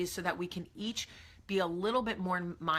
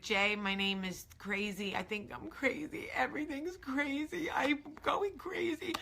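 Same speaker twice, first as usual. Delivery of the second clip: frantic self-mockery; 7 semitones higher than usual. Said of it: "I became the girl that was like".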